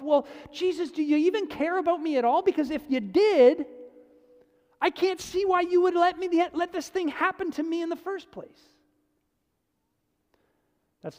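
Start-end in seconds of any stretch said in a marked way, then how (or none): 0.0-8.7 higher, aggrieved plea to Jesus; imitated hurt complaint. Preacher voicing a doubter, half-hearted hurt plea. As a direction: ask Jesus why he let it happen. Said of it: "you kind of think to yourself".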